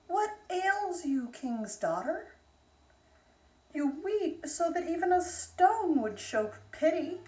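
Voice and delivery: a hint of a concerned frog; kindly frog. Frog speaks to princess.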